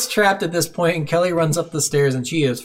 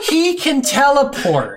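laughing disbelief; 10 semitones higher, slower than usual. Real, not acted. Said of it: "laughing disbelief".

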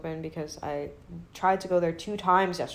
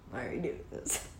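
low, soft acted dismay; muttered disbelief; 4 semitones lower, 7 dB quieter than usual. Sister watching brother's stand-up, acted low mutter. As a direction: question it under your breath.

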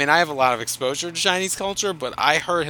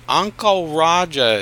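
Uncle Roger; 3 semitones higher, slower than usual. Retold or imitated. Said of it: "who was doing the Uncle".

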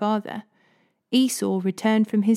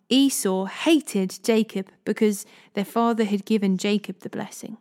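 plain reading voice

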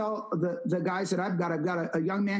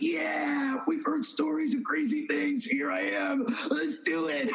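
higher, hammed-up youthful enthusiasm; eager young guy; 6 semitones higher than usual. Eighteen-year-old agreeing to do something crazy, eagerly. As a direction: play an excited teen jumping at a dare.

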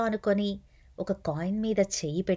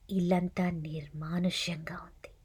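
hushed, softer whisper; eerie whisper; 2 semitones lower, 8 dB quieter than usual. A narrator's reading. Narrator building dread about ghosts, eerie whisper. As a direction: breathe it like a secret. Empty house at night.

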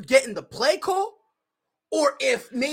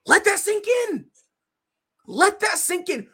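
emphatic high repeat; 10 semitones higher than usual. Real, not acted. Repeated catchphrase, raised pitch.

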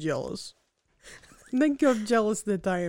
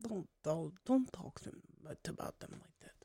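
hushed; 3 semitones lower, 12 dB quieter than usual. Acted. Hushed plea not to mock.

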